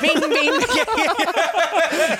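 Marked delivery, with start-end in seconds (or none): 0.0-0.3 high squeaky ming ming. Retold or imitated. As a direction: squeak it like the cartoon frog. Imitating Crazy Frog song.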